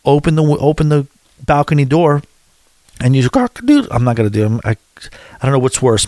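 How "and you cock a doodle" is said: high crowing cock-a-doodle; rooster crow imitation. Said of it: "my cock-a-doodle-doo".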